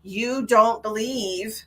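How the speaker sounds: normal talking voice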